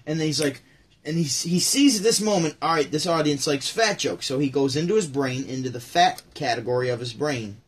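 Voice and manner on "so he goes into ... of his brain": ordinary talk, nothing marked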